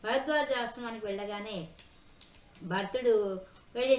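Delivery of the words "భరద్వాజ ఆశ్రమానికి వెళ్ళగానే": plain reading voice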